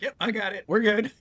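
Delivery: lightly mimicked, high cheery "we're good"; a teasing imitation of an unbothered rider; breezy reassurance. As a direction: brush it off brightly as if nothing's wrong. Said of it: "And he'd be like".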